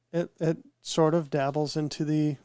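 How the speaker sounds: normal talking voice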